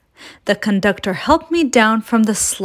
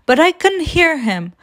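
usual speaking voice

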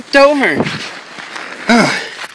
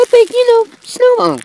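high character voice. Acted.

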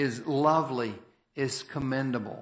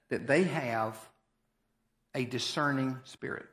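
usual speaking voice

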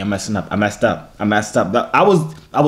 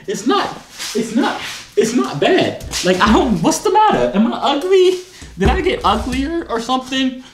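squeaky-high incredulous complaint; laughing, mock-wounded protest; 9 semitones higher than usual. Real, not acted. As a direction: whine in high-pitched disbelief, half laughing.

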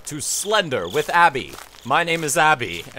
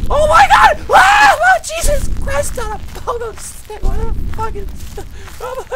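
shrieking, very high panicked oaths; terrified scream; 6 dB louder than usual. Real, not acted. Player jumped by Slender Man, terrified scream. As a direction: scream loud, then keep squealing in panic. Jump scare in horror game.

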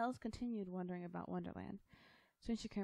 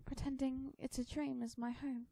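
put-on high, slow girlish voice; heartfelt young girl Alice; 7 semitones higher than usual. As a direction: confide it slowly as a little girl. Alice confides, holding her chest.

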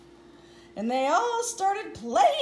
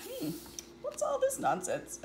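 sceptical thought; 10 semitones higher, 5 dB quieter than usual. Acted.

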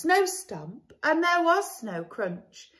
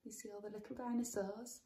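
quiet aside; 3 semitones lower, 16 dB quieter, much faster than usual. Real, not acted. Parent aside to child.

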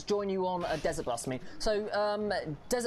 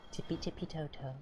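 sneaky sing-song; 6 dB quieter than usual. Pretend. Sneaking past in game.

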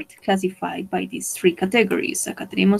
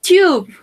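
"Tube" called out higher and louder; "Tube" with an aspirated t, high and loud; vocabulary word called out; 8 semitones higher, 6 dB louder than usual. Shown as a demonstration. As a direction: announce "Tube" loudly, like a new word on the board. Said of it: "Some new words".

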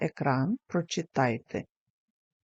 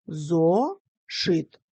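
зо, pause, шит; syllable by syllable; 3 dB louder than usual. Shown as a demonstration. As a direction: split into separate syllables with a pause. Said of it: "слова можна поділити на частинки або на склади".

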